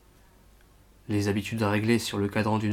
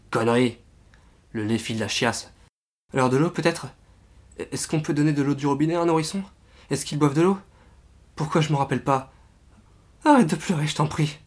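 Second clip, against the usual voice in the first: higher and louder, in a rush of panic; panicked, near tears; 5 semitones higher, 6 dB louder than usual. Acted. Exhausted father alone with a sick baby, panicking. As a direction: spiral into helpless panic.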